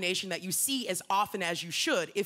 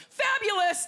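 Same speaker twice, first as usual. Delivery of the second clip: higher, bright passing "Fabulous!"; drive-by fabulous; 9 semitones higher, 3 dB louder, slower than usual. Retold or imitated. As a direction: toss it off like a passing compliment.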